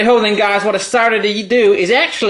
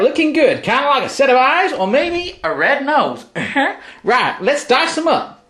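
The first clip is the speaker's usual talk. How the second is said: put-on dodgy Texan accent. Texan tennis player hosting his cooking show, hammed-up Texan accent. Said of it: "let's get back into character".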